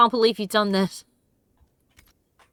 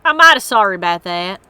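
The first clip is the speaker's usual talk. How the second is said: hammed-up Southern apology; southern accent; 7 dB louder than usual. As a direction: lean into the Southern accent on I'm mighty sorry. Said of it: "practice that sort of accent".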